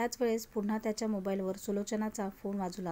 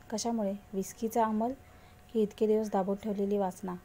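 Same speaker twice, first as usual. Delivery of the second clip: ordinary delivery, nothing marked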